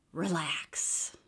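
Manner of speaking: breathy whispered relax; calm yourself under your breath; whispered to self. A narrator's reading. Panting wolf soothes himself, whispering. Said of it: "I told myself".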